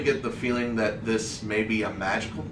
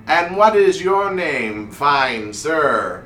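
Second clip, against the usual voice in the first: raised, swelling stage voice; grand wizard showman; 4 semitones higher, 6 dB louder, slower than usual. Acted. Wizard asking the gnome his name onstage, grandly courteous. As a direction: greet him theatrically, loud and high.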